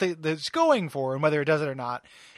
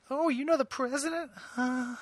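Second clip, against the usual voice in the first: high, put-on swooning fan voice; flirty lady; 10 semitones higher than usual. Bar lady hitting on Beast, high swooning voice. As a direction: gush at him like a starstruck fan. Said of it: "reminds me a lot of Irma".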